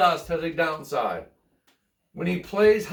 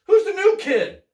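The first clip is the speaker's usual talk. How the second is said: wondering Charlie Brown; 10 semitones higher, 6 dB louder than usual. Acted. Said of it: "Charlie Brown wondered".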